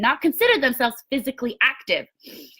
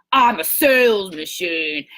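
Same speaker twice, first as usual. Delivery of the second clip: low, slow, self-assured affirmation; confident sales tone; 5 semitones lower, slower than usual. Shown as a demonstration. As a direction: lower and slow, each word planted. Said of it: "do it in that tone like that".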